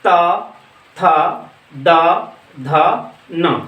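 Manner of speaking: त, थ, द, ध, न each said apart with the tongue on the teeth; dental sounds demo. Shown as a demonstration. Teacher sounds out त थ द ध न for students, spaced dental demo. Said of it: "इसको देखते हैं".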